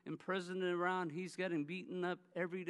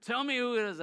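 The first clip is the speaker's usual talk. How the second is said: louder, higher hot-headed demand; angry brother; 5 semitones higher, 5 dB louder, faster than usual. Retold or imitated. Younger brother demands the name, heated and loud. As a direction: demand it like a brother ready to fight. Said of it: "he's like".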